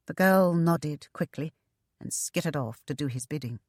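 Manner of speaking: plain reading voice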